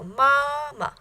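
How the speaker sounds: MAMA with high first MA, light short second; neutral second syllable. Shown as a demonstration. Teacher isolating neutral tone, long-short MAMA. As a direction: stretch the first MA of MAMA, let the last drop. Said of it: "first one was the ma of mama".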